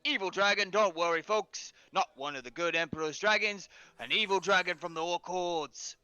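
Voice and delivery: loud market hawker calling out; hawker yelling. Acted. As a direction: yell it like a street vendor. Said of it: "he's just yelling".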